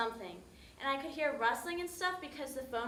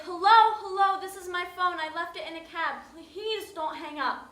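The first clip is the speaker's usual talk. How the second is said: urgent plea; 6 semitones higher, 8 dB louder than usual. Retold or imitated. Pleading with the woman who answered her phone. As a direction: beg them not to hang up.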